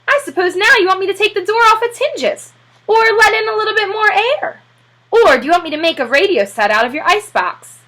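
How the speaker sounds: mock him with heavy sarcasm; sarcastic serviceman. A narrator's reading. Sarcastic serviceman mocks Popper.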